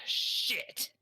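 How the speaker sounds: high, forceful burst of alarm; shocked curse. Retold or imitated. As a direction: blurt the curse as the horror dawns. Acted panic on realizing it's Auschwitz.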